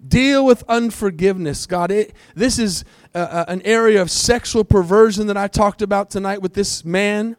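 loud, high fervent prayer; preaching at full force. Real, not acted. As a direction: cry out to God with full force.

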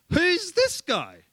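high, slow mock incredulity; cocky young challenger. Preacher playing young David before Goliath, high incredulous voice. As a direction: draw the question out high, sizing up the enemy. Mimicking David's cheeky first words.

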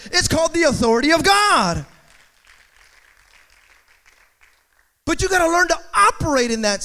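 high, loud preacher's exhortation, easing at the end; fired-up preaching. Acted. Preacher telling the congregation they already have authority, forceful preaching. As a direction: press the congregation hard.

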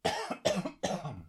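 high sharp cough to clear throat. Real, not acted. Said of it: "Ui, ich hab irgendwas im Hals".

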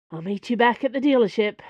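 a touch gruff, clipped short and quick. A narrator's reading. Porthos brushing off Dana, gruffly. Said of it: "Porthos said gruffly".

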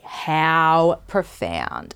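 very slow, dry mock awe; sarcastic. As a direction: drawl it out, unimpressed. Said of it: "I know what you guys are all thinking".

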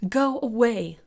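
loud, high-pitched shout. A narrator's reading. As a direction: shout it out to drive him off. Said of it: "Joanne shouted".

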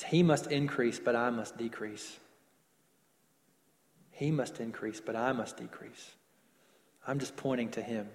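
low and subdued, stepping back in humility; humble, hushed. Acted. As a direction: say it as a quiet, self-effacing motto. Acting out the meek's humble motto.

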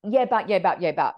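loud, insistent mimicry of objections; mock interrupting. Client cutting in to direct the psychic, mock interrupting. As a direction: imitate someone butting in repeatedly.

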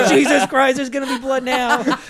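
high, very loud comic protest; outraged yell. Retold or imitated. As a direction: shout it in mock outrage. Acts his outrage at the exam.

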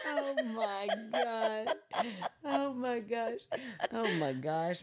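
high, drawn-out words through real laughter; mortified, helpless giggling; laughing in embarrassment. Real, not acted. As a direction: gasp it out while cracking up. Embarrassed, laughing hard at joke.

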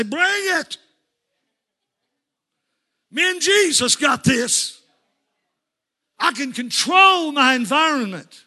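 slow defiant battle cry; bold declaration. Retold or imitated. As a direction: dare the day to come at you. Voicing a bold believer.

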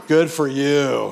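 loud, drawn-out fake praise; mock congratulation. Friend icking someone's wow, mock congratulation. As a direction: stretch it out, exaggerated and insincere. Grudging friend mocked as insincere.

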